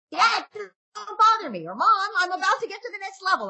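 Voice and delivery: put-on whiny kid. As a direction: play the gamer kid who won't be bothered. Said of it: "And your kid's like".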